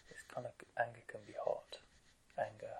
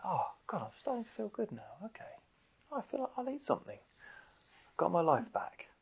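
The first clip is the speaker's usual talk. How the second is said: mock relief; 7 semitones higher than usual. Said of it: "It's like".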